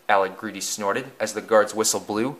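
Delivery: plain reading voice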